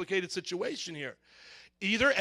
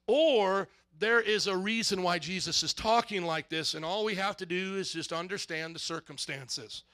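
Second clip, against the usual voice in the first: usual speaking voice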